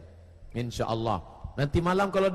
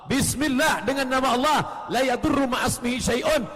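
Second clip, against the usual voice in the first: higher, louder recitation; loud preaching; 6 semitones higher, 4 dB louder than usual. Acted. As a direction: proclaim the prayer to the hall. Loud recitation of prayer formula. (doa dilantangkan dengan nada tinggi)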